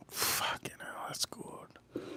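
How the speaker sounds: voiceless, very soft amazement; awed whisper. Real, not acted. Reacting to a great whiskey sip, awed whisper. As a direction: whisper it, floored by the taste. Awestruck after sipping whiskey.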